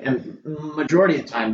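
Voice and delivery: normal talking voice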